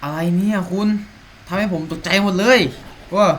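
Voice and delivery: sudden high loud jolt of fright; jumpy yelp of shock; startled outburst. Real, not acted. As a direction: blurt it out, heart still pounding. Startled by a character in game.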